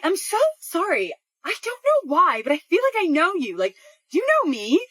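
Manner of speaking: high-pitched, polite imitation of an apologetic woman; apologetic approach.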